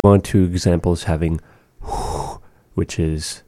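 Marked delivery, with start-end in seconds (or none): 1.8-2.4 h as a soft breathy unvoiced rasp; voiceless h sound. Shown as a demonstration. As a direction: breathe out the h without voice. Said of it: "represented by the letter hua".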